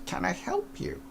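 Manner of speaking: put-on small quavery old lady; little old lady voice. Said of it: "in her little sort of, like, old lady voice".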